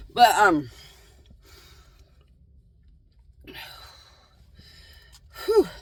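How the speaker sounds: puffing between words; winded, catching breath. Real, not acted. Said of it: "Sorry, I'm out of breath".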